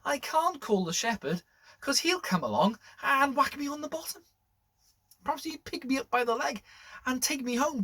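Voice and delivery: a worried little sheep thinking aloud; Barnaby the sheep. Acted. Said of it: "Barnaby thought to himself".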